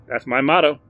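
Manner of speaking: hearty shopkeeper greeting; Barry's eager voice. Acted. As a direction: chirp it like a keen salesman. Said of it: "said Barry".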